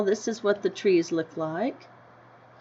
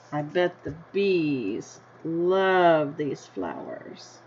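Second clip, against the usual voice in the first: ordinary delivery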